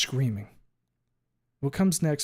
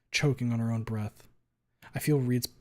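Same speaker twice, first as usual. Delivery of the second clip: usual speaking voice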